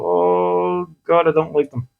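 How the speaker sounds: mock inner voice, mildly uneasy and repelled; uneasy distaste. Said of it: "And you go".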